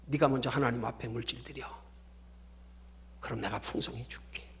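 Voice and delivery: low, very soft divine voice; God's reply. Acted. God answering the bargaining believer, low and hushed. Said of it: "뭐라 그러시는 줄 아세요".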